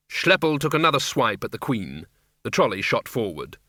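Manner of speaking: plain reading voice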